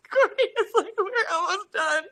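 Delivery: high, slow laughing through words; high laughing sob-story; laugh-crying. Real, not acted. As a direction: laugh helplessly while telling it. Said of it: "I'm crying".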